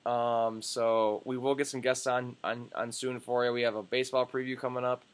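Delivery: ordinary delivery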